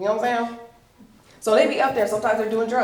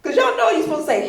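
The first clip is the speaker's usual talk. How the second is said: ordinary delivery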